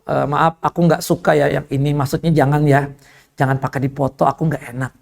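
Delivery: low, apologetic request; polite, restrained refusal. Retold or imitated. Preacher politely declining to be photographed, restrained and low. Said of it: "sikap santun tuh ada".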